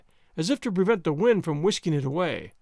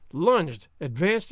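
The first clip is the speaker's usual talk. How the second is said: ordinary delivery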